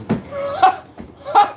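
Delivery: high-pitched cackle of disbelief; high laugh. Acted. Boss mocking the big contract ask, high cackle.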